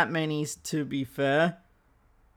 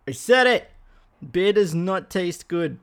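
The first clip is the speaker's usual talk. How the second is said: defiant declaration; 3 dB louder than usual. Real, not acted. Podcaster admitting dislike of beer, defiant and loud. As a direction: own it loudly and defiantly.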